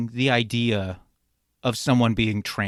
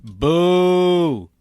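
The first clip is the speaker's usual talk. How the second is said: real boo; 4 semitones higher, 5 dB louder than usual. Real, not acted. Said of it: "booing the joke".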